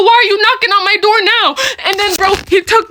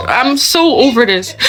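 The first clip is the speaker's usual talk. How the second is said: ordinary talk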